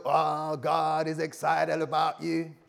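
slow, drawn-out hype-preacher call; rousing American pulpit cadence; American preacher style. Shown as a demonstration. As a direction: stretch it out like a revival preacher. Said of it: "if I go the American route".

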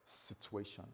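troubled inner thought. Acted. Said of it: "he begins to think".

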